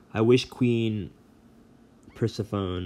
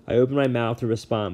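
plain reading voice

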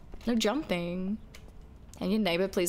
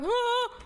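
startled yelp; 14 semitones higher than usual. Real, not acted. Sudden yelp.

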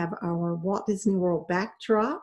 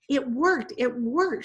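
excited; 5 semitones higher, slower than usual. A narrator's reading. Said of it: "she greeted them".

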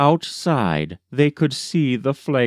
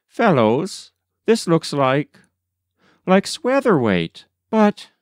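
higher, halting and unsure; hesitant embarrassed man; 6 semitones higher than usual. A narrator's reading. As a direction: stumble over it, embarrassed. Swatherwaite embarrassed reading the address.

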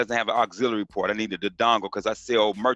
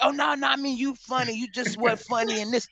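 mock trolls; 11 semitones higher than usual. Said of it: "they be like".

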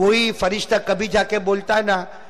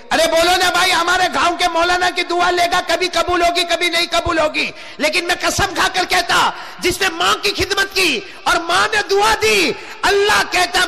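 high-pitched sermon shout; fiery preaching; 9 semitones higher than usual. Acted. Preacher declaring Allah's oath to the congregation, fiery preaching. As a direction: proclaim it at full pitch.

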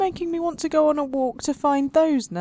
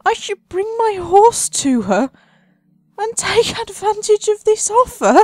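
mock indignation; 9 semitones higher, 7 dB louder than usual. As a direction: play it as a hammed-up offended question. Indignant at the quest offer.

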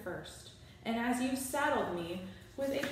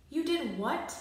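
mock yell; 7 semitones higher, 3 dB louder than usual. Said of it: "I yell at my mother".

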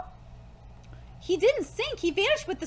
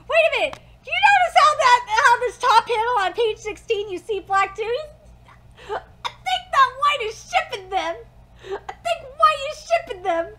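louder, higher, eager realisation; excited discovery; 5 semitones higher, 7 dB louder than usual. Real, not acted. Vlogger interrupting the reading, thrilled by a manga panel. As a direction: burst in as if you just spotted something.

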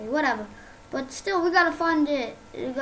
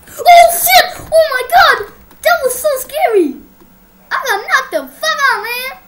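loud, high shrieks of shock; knocked out and screaming in panic; terrified screaming; 8 semitones higher, 12 dB louder than usual. Real, not acted. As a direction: scream in real fright. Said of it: "I'm sorry for screaming".

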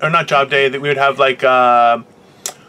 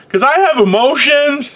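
high, drawn-out fake wail; hammed-up overwrought cry; mock emotional outburst; 11 semitones higher, 3 dB louder than usual. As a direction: wail it out as a joke, slowly. Jokes about trying not to cry.

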